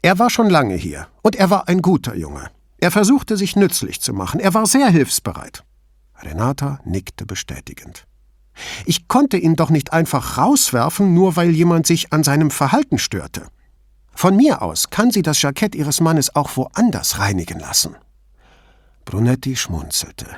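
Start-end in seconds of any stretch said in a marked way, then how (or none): 0.0-5.6 raised pitch, heated and insistent; indignant defense. A narrator's reading. Shop owner defending the helpful boy to Brunetti, indignant. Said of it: "Ihr Zorn schien sich nun gegen ihn zu richten". 8.8-18.0 indignant. A narrator's reading. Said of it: "Ihr Zorn schien sich nun gegen ihn zu richten".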